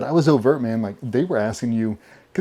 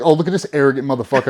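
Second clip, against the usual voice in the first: loud, fast, higher incredulous jab; mock astonishment; 4 semitones higher, 8 dB louder, faster than usual. Cadre reacting to trainee's bold answer, mock astonishment. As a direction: call him out in amazed disbelief. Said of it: "His eyes got real big".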